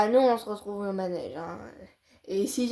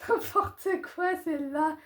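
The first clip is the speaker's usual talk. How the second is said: mock whine; 7 semitones higher than usual. Mock crying.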